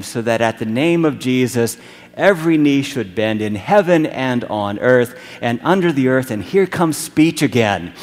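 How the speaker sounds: loud, ringing Bible reading; scripture reading. Acted. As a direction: proclaim the hymn to the congregation. Reads scripture with rising force.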